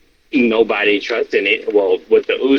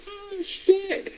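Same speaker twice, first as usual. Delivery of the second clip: high mock surprise; 10 dB quieter than usual. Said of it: "I was like".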